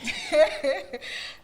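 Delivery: bursting high laugh; loud laugh. Real, not acted. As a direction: laugh out loud at her reaction. Host laughs at reaction.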